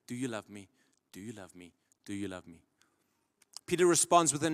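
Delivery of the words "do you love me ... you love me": very soft, low repeated question; hushed questioning. Retold or imitated. Jesus asks Peter three times, hushed and low. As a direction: ask it quietly, sinking lower and softer.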